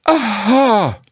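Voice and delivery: high, unsure Piglet aha; a timid attempt at menace; Piglet's aha. Piglet repeats his threat.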